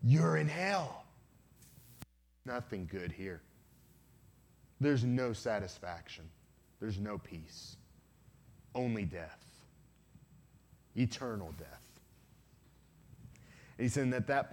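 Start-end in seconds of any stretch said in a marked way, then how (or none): 0.0-1.0 slow, drawn-out beast taunt; a monster slowly relishing the verdict; gloating hell beast. Retold or imitated. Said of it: "laughed even louder and said". 2.4-12.0 low, soft, menacing beast voice; a demon's slow, grave sentence with long pauses; hell beast. Retold or imitated. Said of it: "the beast laughed even louder and said".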